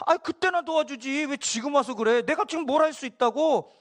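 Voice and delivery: high-pitched imagined protest; grumbling complaint. Acted. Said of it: "막 이런 인간적인 생각을 막 하면서".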